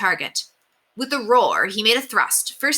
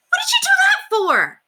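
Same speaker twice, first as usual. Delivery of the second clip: much higher, loud outraged cry; shocked shout; 6 dB louder than usual. Acted. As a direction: yell it in disbelief. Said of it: "William cried".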